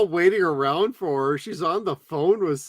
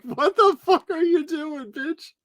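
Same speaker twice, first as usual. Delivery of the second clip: much higher, incredulous squeal; mock disgusted; 11 semitones higher, 5 dB louder than usual. Mocking the kid horrified by the kissing, much higher. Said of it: "It's like".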